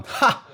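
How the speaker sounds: one sharp, high, loud "ha"; loud laugh burst. Retold or imitated. Retelling breaking down in therapy, imitating one loud laugh. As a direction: bark out a single loud laugh. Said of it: "我就大笑一声".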